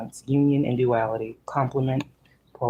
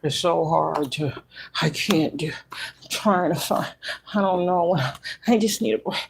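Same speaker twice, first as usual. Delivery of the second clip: mock a tired complainer; mocking whiner; 4 semitones higher than usual. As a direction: whine it out like someone always complaining. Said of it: "people that's like".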